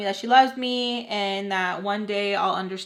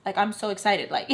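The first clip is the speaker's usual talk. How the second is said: usual speaking voice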